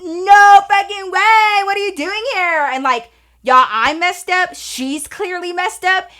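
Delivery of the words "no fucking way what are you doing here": loud, slow and high, stretching every word; excited disbelief. Retold or imitated. Seeing her old classmate walk out, loud high excitement. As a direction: shout it like you can't believe your eyes. Shocked reunion at dorm party.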